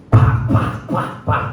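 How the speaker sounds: sharp percussive smacking syllables; imitated blows. Preacher shows being pounded by a hammer, percussive imitated blows. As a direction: punch out each hit as a separate noise.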